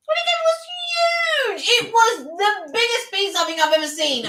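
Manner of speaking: high, drawn-out shout of amazement; stretched, high-pitched exclamation; amazed exclamation. Real, not acted. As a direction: cry out at the sheer size. Exclaiming over giant pizza's size.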